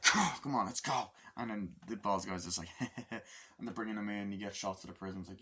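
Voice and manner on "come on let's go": mock guard's call. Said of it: "so they're like".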